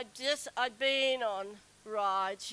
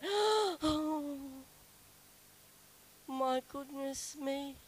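shocked; 3 semitones higher, much slower than usual. Retold or imitated. Shocked exclamation beside stranger.

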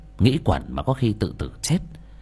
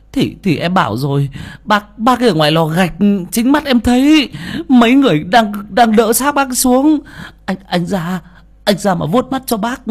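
much higher, louder, stammering; agitated report; 12 semitones higher, 6 dB louder than usual. Acted. Servant boy telling Phú he saw the body taken down, high agitated voice. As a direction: pour out what he saw, all worked up.

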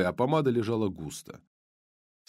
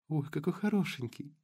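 lightly voiced blonde; 5 semitones higher, 6 dB quieter than usual. Blonde cooing.

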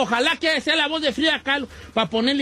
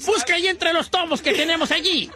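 higher-pitched, exasperated boss scolding; indignant mock rant, pitched up; 7 semitones higher than usual. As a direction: scold the staff in comic exasperation. Boss scolds staff over missing text.

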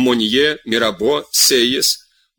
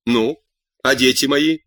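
usual speaking voice

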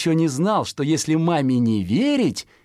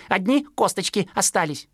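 higher sparrow chick voice; higher baby-bird voice; fledgling remarks; 8 semitones higher than usual. Acted. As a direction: say it like a naive chick. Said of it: "сказал Пудик".

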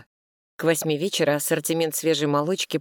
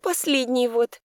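regretful; 8 semitones higher than usual. A narrator's reading. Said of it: "ответила с сожалением".

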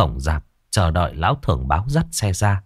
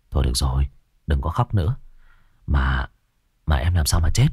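gentle; 7 semitones lower, 3 dB quieter, faster than usual. Acted. Comforting crying ghost child.

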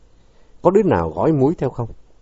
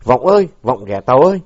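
narrated shout, neck straining; straining call; 5 dB louder than usual. A narrator's reading. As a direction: holler for a friend who isn't answering. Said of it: "gân cổ gọi".

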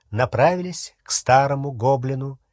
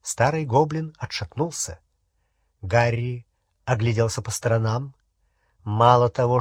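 usual speaking voice

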